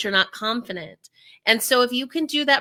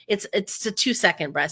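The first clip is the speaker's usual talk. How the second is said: normal talking voice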